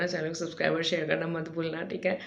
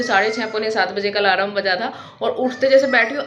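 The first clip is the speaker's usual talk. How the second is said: energetic vlog intro; 5 semitones higher, 8 dB louder than usual. Acted.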